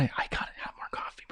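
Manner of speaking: hushed, voiceless whisper; whispered aside. Pretend. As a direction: whisper it as an urgent note to self. Mock aside after losing track.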